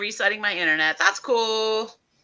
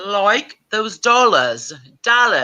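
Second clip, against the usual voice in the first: slow, drawn-out mock Australian accent; practising a mock Australian accent; 2 semitones higher, slower than usual. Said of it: "It's not dollars".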